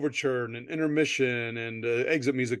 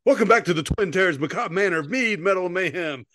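podcast announcer; 5 semitones higher, 3 dB louder than usual. Acted. Show intro announcement.

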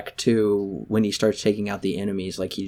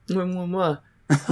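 big wet smooch; 8 semitones higher, slower than usual. Retold or imitated. Mocking the kissing scene.

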